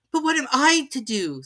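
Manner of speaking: put-on high, pleading girl's voice; a polite girl asking; young Alice. A narrator's reading. Alice figure voice.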